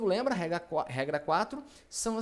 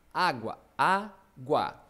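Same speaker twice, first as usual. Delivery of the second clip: "água" very slow and louder, split á-gua; syllable by syllable; 5 dB louder, much slower than usual. Shown as a demonstration. Teacher dividing a word for the class, syllable by syllable. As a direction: pull "água" apart into two deliberate syllables. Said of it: "vou separar as sílabas".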